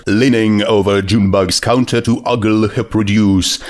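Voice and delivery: plain reading voice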